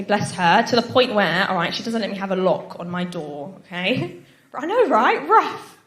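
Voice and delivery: ordinary talk, nothing marked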